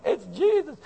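high, hammed-up televangelist cry; mock TV preacher. Pastor mocking TV preachers in a high, hammed-up preacher voice. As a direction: send up the hanky-waving TV preacher, pitched high. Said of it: "the people you see on T V with the hanky going".

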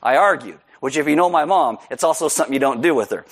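normal talking voice

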